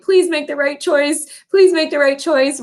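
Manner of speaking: high, pleading cheerleader voice; inner cheerleader. Retold or imitated. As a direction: cheer your kid on, begging them to choose well. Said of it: "my little inner cheerleader".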